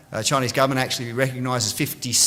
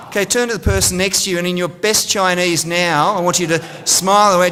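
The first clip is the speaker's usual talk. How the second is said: usual speaking voice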